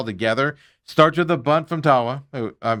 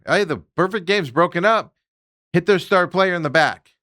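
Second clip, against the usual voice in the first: normal talking voice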